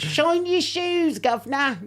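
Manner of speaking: high, slow Cockney street-seller voice; Cockney accent. Retold or imitated. Guest imitating a Cockney shoeshine man to joke about British accents. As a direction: stretch it out in a hammed-up Cockney accent. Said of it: "that's Cockney".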